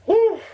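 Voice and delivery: high, loud mock cheer; a short ironic whoop; a hammed-up victory whoop; sarcastic woo. Real, not acted. Mock celebration after ruined life.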